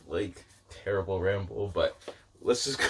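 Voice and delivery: ordinary delivery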